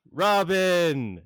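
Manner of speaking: loud, high name called out; glad call. Acted. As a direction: greet a friend warmly. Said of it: "he goes".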